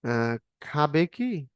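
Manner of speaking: drawn-out খাবে কি with ki stretched at the end; a slow teacher's demo of the question কি; slow yes-no question. Shown as a demonstration. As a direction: ask তুমি খাবে কি very slowly, leaning on কি. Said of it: "এখন উত্তর হলো হ্যাঁ".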